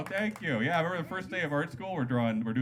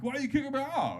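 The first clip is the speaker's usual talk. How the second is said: much higher-pitched protest; protesting drunk; 12 semitones higher than usual. Acted. Hammered guy objecting to being kicked out, protesting. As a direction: push it up high, indignant. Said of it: "these two hammered guys walk in".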